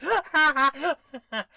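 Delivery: loud high burst of laughter; real laugh breaking out; cracking up loudly; big laugh. Real, not acted. Laughs at nearly typing yes.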